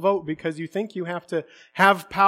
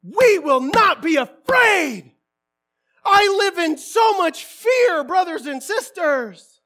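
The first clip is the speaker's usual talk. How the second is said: high, loud, drawn-out declaration; slow, shouted pulpit confession; forceful preaching; 11 semitones higher, 7 dB louder, slower than usual. Real, not acted. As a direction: cry it out slowly to the congregation.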